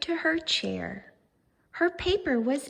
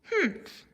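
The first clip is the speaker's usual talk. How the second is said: higher dismissive humph; scornful humph; 7 semitones higher than usual. Acted. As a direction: scoff at the framed dot. Vashti scoffs.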